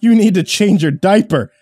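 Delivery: high, loud, slow taunting; sarcastic mockery. A narrator's reading. Steve taunts the narrator after the empty chamber, sarcastic. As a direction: drag out the taunt. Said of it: "he said with marked sarcasm".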